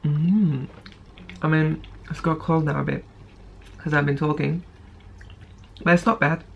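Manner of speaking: eating between words; talking while chewing. Real, not acted. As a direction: mouth partly full of food. Seen 0: Takes forkful at +0.2, mouth working while talking, keeps eating.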